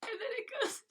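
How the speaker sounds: high, squeaky laughing voice; helpless high-pitched giggly lead-in; cracking up. Real, not acted. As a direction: squeak it out through real laughter. Cracking up at the book.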